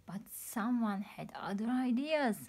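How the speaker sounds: plain reading voice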